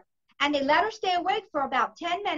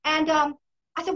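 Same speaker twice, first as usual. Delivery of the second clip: usual speaking voice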